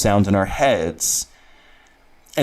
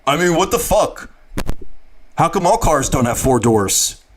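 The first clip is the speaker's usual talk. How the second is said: lightly voiced disgruntled guy; Havoc; 4 semitones higher than usual. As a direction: grumble it as a put-on character. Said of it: "That's my Havoc".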